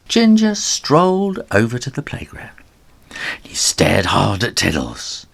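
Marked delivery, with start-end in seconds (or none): none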